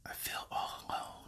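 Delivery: very quiet, heavy whisper, long pauses between; tiny whimper. Acted. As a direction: whisper it like a faint little inner voice. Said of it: "It's gonna be a little whimper".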